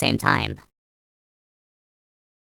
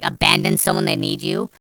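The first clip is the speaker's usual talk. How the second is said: ordinary talk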